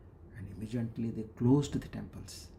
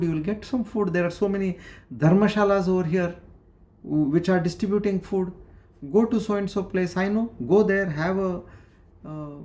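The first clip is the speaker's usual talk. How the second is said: higher, pressing concerned voice; worried stranger; 6 semitones higher, 4 dB louder than usual. Retold or imitated. Disguised king as a beggar urges Lalita to eat, higher and pleading. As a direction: coax her like an anxious passer-by.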